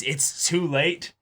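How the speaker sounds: usual speaking voice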